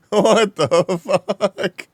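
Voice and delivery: slow, laughing what the fuck; laughing disbelief. Real, not acted. Laughing disbelief at chat message.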